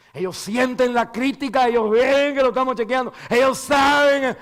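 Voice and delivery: high, loud fervent preaching; impassioned sermon climax; preaching shout. Real, not acted. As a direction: drive it home to the pews. Preaching at full force.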